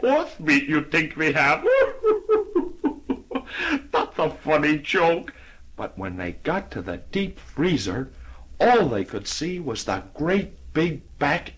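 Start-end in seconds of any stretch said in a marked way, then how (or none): 0.0-5.3 high laugh then slow chuckling words. Acted. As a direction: laugh through the line. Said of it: "He started to laugh".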